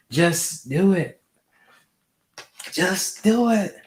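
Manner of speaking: high, slow, hammed-up Shia LaBeouf shout; a badly faked Shia LaBeouf; bad acting. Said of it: "sorry for the terrible voice acting".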